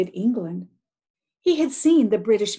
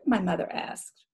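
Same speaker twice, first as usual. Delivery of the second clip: usual speaking voice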